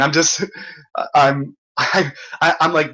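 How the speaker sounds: normal talking voice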